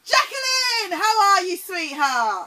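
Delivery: high, loud gushing welcome; excited greeting. Real, not acted. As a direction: greet her like a long-lost friend. Greeting guest with delight.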